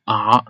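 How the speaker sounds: "a" going down, then bouncing back up; fall-then-rise pitch on "a"; dipping third tone. Shown as a demonstration. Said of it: "First you go down and then you bounce back".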